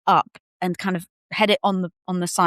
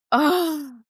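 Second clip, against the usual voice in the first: relieved oh; 9 semitones higher than usual. Retold or imitated. Relief after birth.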